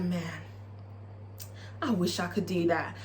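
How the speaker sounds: a touch of longing, said low and soft; wistful. Retold or imitated. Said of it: "and Farrah's like".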